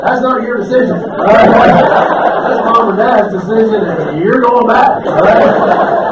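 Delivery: loud, breaking into laughs; can't hold back the laughter mid-line; laughing at his own story; laughing through it. Real, not acted.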